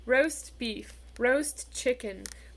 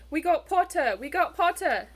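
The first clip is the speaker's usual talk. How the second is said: loud, higher triumphant yell; twins yelling; 6 semitones higher, 7 dB louder than usual. A narrator's reading. The Weasley twins celebrating Harry's sorting with a loud, triumphant yell. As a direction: cheer it out across the table. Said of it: "the Weasley twins yelled".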